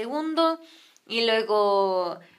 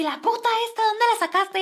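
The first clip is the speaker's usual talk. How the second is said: high-pitched accusing voice; mock a jealous interrogation; ranting wife; 9 semitones higher than usual. Señora demanding where he found her, imitated rant. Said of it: "empezó la señora de que".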